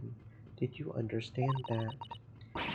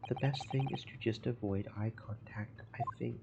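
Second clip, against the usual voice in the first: unvoiced whisper behind a hand; whispered aside. Real, not acted. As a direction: whisper it so the sailor won't hear. Aside about the rude sailor.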